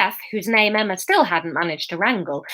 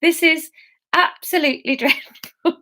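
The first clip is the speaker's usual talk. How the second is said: higher, real laughter through words; giggling; 6 semitones higher than usual. Real, not acted. Narrator despairs of own accent, laughing. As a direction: laugh at your own botched attempt.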